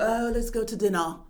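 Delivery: put-on a light Mid-Atlantic Hepburn accent; posh old-Hollywood Hepburn accent, lightly done; Katharine Hepburn accent. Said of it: "Katharine Hepburn accent".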